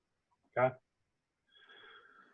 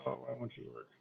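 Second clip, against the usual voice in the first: quiet frustrated plea to the computer; softly begging it to work; pleading with a machine; 16 dB quieter, faster than usual. Real, not acted. Software not working, muttering at it.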